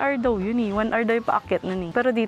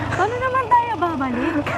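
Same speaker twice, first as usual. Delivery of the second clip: nervous laugh; 12 semitones higher than usual. Real, not acted. Worried laugh on descent.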